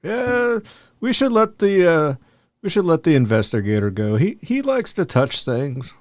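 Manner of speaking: ordinary talk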